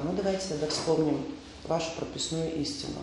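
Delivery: usual speaking voice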